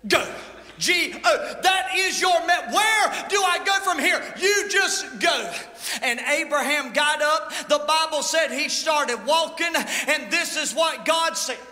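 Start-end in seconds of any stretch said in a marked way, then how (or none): 0.1-8.7 full-force preaching. Acted. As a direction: spell it out and thunder the command.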